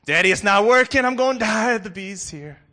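put-on high, loud terrified child's wail; panicked child. Boy in car screams to father, terrified. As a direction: shriek like a kid sure he'll die.

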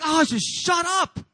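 fed-up snap back at conscience; irritated retort. Acted. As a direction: brush off the nagging voice angrily. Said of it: "and you're like".

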